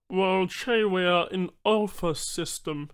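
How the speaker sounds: high, slow alien voice; patient alien explaining slowly; alien Banu trader. Acted.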